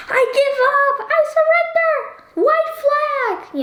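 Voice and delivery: high, squeaky mock surrender; hands-up comic squeal of giving up. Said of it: "I would be like".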